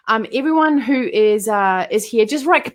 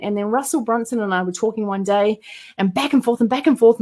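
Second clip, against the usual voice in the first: ordinary delivery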